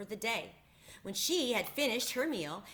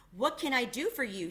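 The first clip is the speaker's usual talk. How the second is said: ordinary delivery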